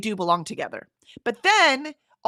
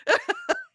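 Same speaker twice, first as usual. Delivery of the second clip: high laugh. Real, not acted. Laughing at her cleaning pride.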